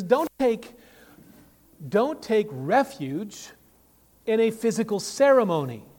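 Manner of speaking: loud, high preaching warning; forceful pulpit exhortation; emphatic warning. Retold or imitated. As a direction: warn the congregation firmly, raising the voice. Preacher voices Paul's emphatic warning.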